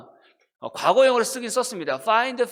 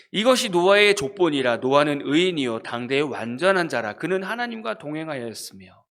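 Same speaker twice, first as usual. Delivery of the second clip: a measured, formal reading of the verse; scripture reading; 4 semitones lower, 3 dB louder than usual. Acted. Preacher reading Genesis to the congregation, formal. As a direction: read the Bible verse aloud to the congregation.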